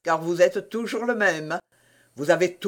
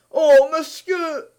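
high, loud, drawn-out entreaty; hammed-up supplicating wail; pleading; 9 semitones higher, 8 dB louder, much slower than usual. A narrator's reading. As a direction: implore the master, stretching the words slowly. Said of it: "d'un air suppliant".

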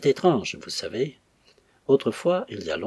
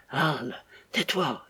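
usual speaking voice

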